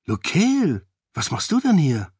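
name pitched high, then falling; surprised. A narrator's reading. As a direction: greet an unexpected cousin in mild astonishment. Surprised to find cousin there. (überrascht, mit hoch angesetztem Namen)

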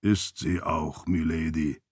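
low, slow, soft puzzlement; a puzzled attendant answering quietly; confused. A narrator's reading. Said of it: "sagte Archie verwirrt". (leicht verwirrt, tief und langsam)

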